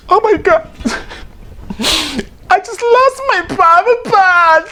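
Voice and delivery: very high, slow, shocked falsetto wail through laughter; shocked squeal. Retold or imitated. Newly divorced person to the audience, a high laughing falsetto wail. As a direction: wail it way up high like you lost something precious. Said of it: "You're like".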